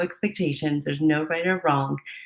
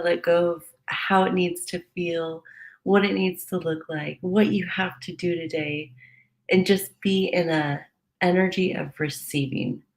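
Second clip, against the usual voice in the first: ordinary talk, nothing marked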